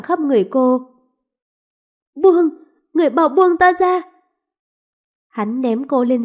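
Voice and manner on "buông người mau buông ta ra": high, louder cry of resistance; panicked protest. Acted. Woman fighting off the lord's grip, panicked. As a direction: struggle to break free. Woman struggles against forced embrace.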